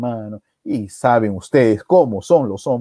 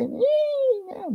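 put-on baby squeal; 6 dB quieter than usual. Imitates newborn at birth.

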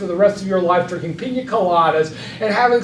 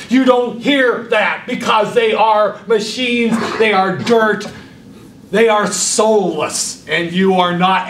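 slow and pounding; emphatic; slower than usual. Shown as a demonstration. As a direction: hammer each clause home. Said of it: "look what I did to you".

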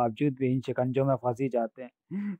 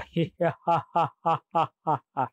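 long, pulsed villain guffaw; theatrical gloating monster cackle; terrifying demon laugh; 3 semitones higher than usual. A narrator's reading. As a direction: roll out the laugh beat after beat. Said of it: "भयानक ढंग से हंसता हूँ".

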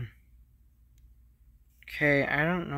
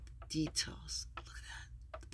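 whispering; 9 dB quieter than usual. Real, not acted. Unboxing whisper to camera.

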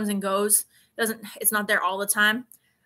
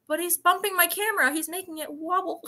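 high-pitched playful complaint; mock whiny protest; 8 semitones higher than usual. Complaining to sister about cat bumping camera, whiny protest. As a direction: protest in a raised, pleading pitch.

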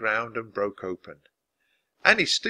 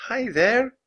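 put-on much higher, little-girl greeting; small voice; 12 semitones higher, 4 dB louder than usual. A narrator's reading. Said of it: "Annie said in a small voice".

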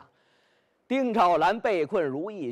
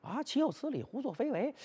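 much softer, a distant curse heard from outside; muffled, as if through a wall; 4 semitones higher, 9 dB quieter than usual. A narrator's reading. The boy outside hears the curses from inside the hall, muffled and far. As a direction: let it sound far away behind closed doors. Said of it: "这人一听这里头说什么".

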